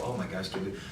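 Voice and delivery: nervous self-doubt. Retold or imitated. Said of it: "and you go".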